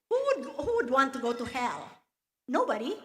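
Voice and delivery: loud, quick rhetorical outburst; emphatic disbelief. Retold or imitated. Woman retelling her reply to talk of hell, loud and emphatic.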